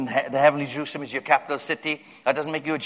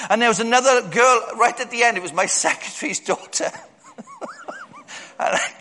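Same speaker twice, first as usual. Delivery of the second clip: ordinary talk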